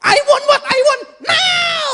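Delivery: very high, loud, drawn-out shriek; hammed-up toddler scream on NOW; child's tantrum. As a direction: bawl it like a spoiled kid. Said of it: "katanya begitu tuh".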